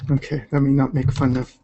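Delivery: normal talking voice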